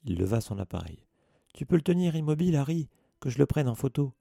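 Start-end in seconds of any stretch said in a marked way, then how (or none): 1.5-4.2 eager. A narrator's reading. Eager boy wants a photo.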